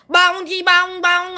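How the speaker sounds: mock how copycats think you sound; mocking imitation. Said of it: "I don't talk like that".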